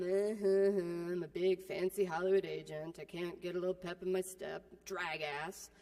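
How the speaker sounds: a hint of a sluggish Hollywood agent; lazy agent. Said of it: "So my agent".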